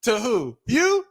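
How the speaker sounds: mocking squeak. Shown as a demonstration. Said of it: "be like".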